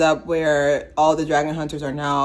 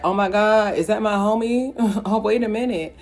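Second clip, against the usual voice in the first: higher-pitched comic double take; mock surprise; 6 semitones higher than usual. Reviewer voicing Toothless spotting his friend, mock surprise. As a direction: voice a startled dragon, pitched up.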